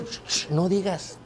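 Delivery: slow, soft hush; shushing warning. Retold or imitated. Pastor hushing the sister who asked for ham, soft warning.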